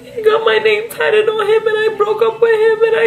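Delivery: high-pitched hammed-up wail, opening with a gasp; fake-bawl the words in a stretched, high cry; mock crying. Student sobbing to the guidance counselor about the tattoo, mock crying. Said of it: "cried like that".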